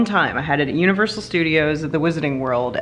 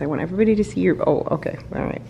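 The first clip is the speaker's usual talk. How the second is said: ordinary talk, nothing marked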